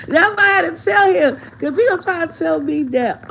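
laughing. Real, not acted. Laughs at own retort.

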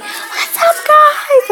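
high-pitched screamed greeting; screamed intro. Shown as a demonstration. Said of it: "and I scream, like, really loud".

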